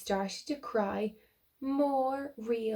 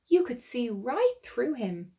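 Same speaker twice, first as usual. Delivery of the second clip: ordinary delivery, nothing marked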